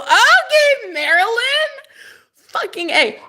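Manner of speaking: high laughing cheer; excited. Real, not acted. Thanking the reader Marilyn, high and delighted.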